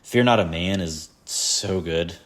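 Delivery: ordinary talk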